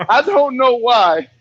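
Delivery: slow, laughing through the words; real laughter, slowed. Real, not acted. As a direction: laugh it off, drawn out. Laughing at toilet joke.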